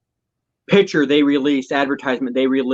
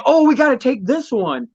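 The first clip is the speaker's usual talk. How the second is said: excited quote; 9 semitones higher than usual. Retold or imitated. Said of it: "She's like".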